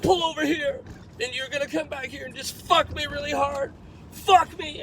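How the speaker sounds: hammed-up frantic demand; mock yelling. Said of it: "she starts screaming at me".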